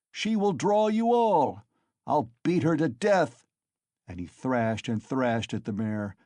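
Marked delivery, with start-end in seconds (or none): 0.1-3.4 furious. Acted. Mikolka threatening to beat the mare, furious shout. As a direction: rage at the mare. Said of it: "beside himself with fury".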